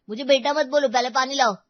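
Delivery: loud, angry yell; angry shout. Acted. Spoiled son yells at his pleading mother for water. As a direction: shout her down.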